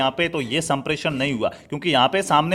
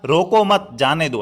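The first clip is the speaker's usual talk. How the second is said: रोको मत joined slowly, break, then जाने दो; slow, with मत attached to रोको; pause after मत; slower than usual. Shown as a demonstration. Teacher showing what you heard instead, slow pause after मत. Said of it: "लेकिन आपने क्या सुना".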